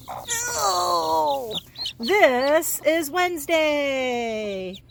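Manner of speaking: slow, high, drawn-out pet talk; drawn-out cooing. Real, not acted. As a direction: stretch it out lovingly to the chick. Gushing over found chick.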